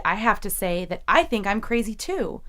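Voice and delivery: usual speaking voice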